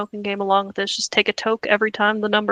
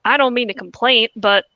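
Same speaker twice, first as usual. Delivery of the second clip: Southern accent. Real, not acted. Host reads a customer's late-sale complaint aloud, Southern accent. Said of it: "slipping into a Southern accent reading this".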